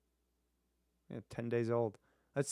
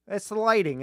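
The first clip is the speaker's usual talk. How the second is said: high, loud sarcastic excuse; loud scoffing mock; mocking shout; 10 semitones higher, 11 dB louder than usual.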